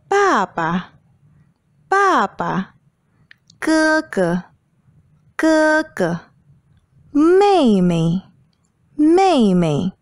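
Mama, Papa, Ge ge, Mei mei with a quick, light second syllable; neutral tone. Shown as a demonstration. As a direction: stress the first syllable of Mama, Papa, Ge ge, Mei mei, let the second drop short and toneless. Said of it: "you can read the syllable quickly without regard to pitch".

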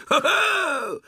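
high, loud bouncy tiger whoop. A narrator's reading. Tigger bouncing onto Rabbit, loud high whoop. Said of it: "z głośnym".

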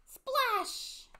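sudden high, loud storybook burst; excited exclamation. A narrator's reading. Narrator reaches Pip-Pip's leap into the ocean, exclaiming excitedly.

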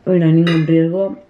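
ordinary talk

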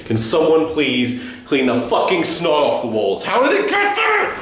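complaint rising to a very high cry; exasperated complaint. Acted. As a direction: build from a plea to shrill outrage. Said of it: "I have to be that guy".

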